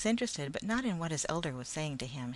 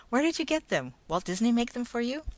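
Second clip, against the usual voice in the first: mock-jokey, higher and louder; joking; 8 semitones higher, 7 dB louder than usual. A narrator's reading. Manager teasing the ant about his costume, jokey. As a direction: tease the ant with a little joke. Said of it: "He followed his own little joke".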